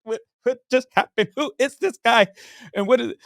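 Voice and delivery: high-pitched astonished questioning; acting out stunned wonder; awestruck. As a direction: reel in amazement, voice way up. Retells being awestruck by the MC.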